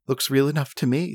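ordinary talk, nothing marked

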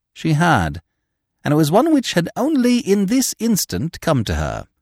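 ordinary delivery

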